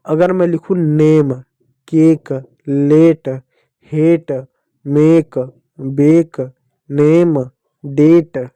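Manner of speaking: each word set apart, a said as e; reading example words. Shown as a demonstration. Teacher showing pupils the e sound, words given one by one. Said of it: "ए को ना ए की मात्रा पढ़ते हो".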